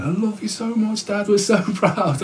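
put-on high, gushing young girl's voice; lightly voiced young daughter; daughter's voice. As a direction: voice the daughter telling dad she's proud. Daughter voiced hugging her dad.

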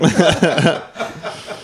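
loud laughing burst; real laughter. Real, not acted. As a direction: laugh hard at the memory. Laughing at the story.

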